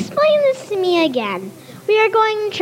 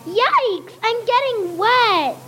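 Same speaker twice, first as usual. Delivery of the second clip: cried out; 7 semitones higher, slower than usual. Acted. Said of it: "cried out".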